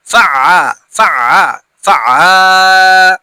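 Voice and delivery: "ফাআ" with the final আ stretched long; drawn-out vowel. Shown as a demonstration. Teacher showing alif madda, final vowel drawn out. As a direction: hold the last vowel of "ফাআ", longest on the last take. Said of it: "টান হবে".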